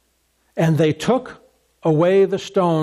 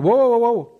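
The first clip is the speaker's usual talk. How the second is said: alarmed protest; 4 semitones higher, faster than usual. Pretend. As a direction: stop him in alarm before the stone moves. Martha objects to opening tomb.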